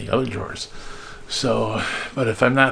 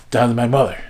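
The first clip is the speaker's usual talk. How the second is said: normal talking voice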